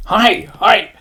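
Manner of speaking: high mock-Japanese chorus; Japanese pilots' cry. Pilots answering their admiral, high mock-Japanese cry. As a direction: chime in like obedient recruits. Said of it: "all these guys are going".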